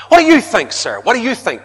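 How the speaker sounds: loud, high, pressing question; eager questioner. Retold or imitated. A churchgoer pressing the preacher, high and loud. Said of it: "someone asked a preacher".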